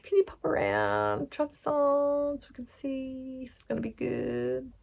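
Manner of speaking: high, squeaky-pitched gushing client call; put-on girlfriend voice. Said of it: "Probably not in that voice".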